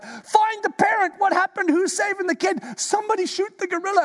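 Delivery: high, frantic crowd panic; hammed-up alarm shouting for help; high panicked mock crowd. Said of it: "we all start going nuts".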